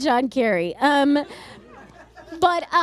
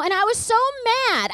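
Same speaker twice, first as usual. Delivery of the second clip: ordinary delivery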